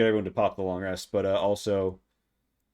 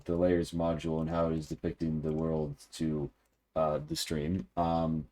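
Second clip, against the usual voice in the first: ordinary talk, nothing marked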